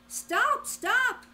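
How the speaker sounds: high loud cry of stop. A narrator's reading. Old man in the field shouting for him to stop. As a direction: yell after the running cookie. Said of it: "cried the little old man".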